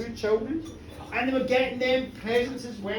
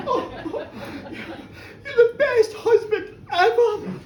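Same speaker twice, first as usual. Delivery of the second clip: overjoyed, tearful; 10 semitones higher, 4 dB louder than usual. Acted. Molly thanking her husband Mike, high happy tearful gush. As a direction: gush your thanks like you might cry. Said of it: "she went".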